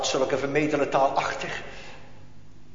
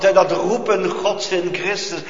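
ordinary talk, nothing marked

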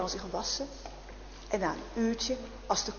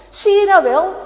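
loud, high triumphant cry; jailer's loud exclaim; 9 semitones higher, 14 dB louder than usual. Acted. Jailer to guard about the empty cell, loud and high. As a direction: blurt it out like a smug jailer.

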